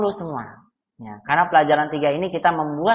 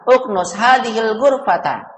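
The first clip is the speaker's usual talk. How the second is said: high-pitched firm order; raised-voice imperative; commanding; 5 semitones higher than usual. Shown as a demonstration. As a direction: give a drilled command, pitch held up. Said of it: "intonasinya naik ke atas ya".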